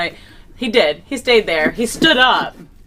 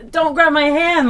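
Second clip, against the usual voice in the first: retold scream; 9 semitones higher than usual. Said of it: "I'm screaming".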